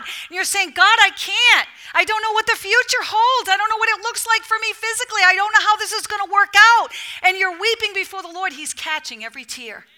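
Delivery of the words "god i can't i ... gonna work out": higher-pitched, acted plea to God; crying out. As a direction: plead upward, imitating someone at the end of their strength. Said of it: "you're just crying out to God".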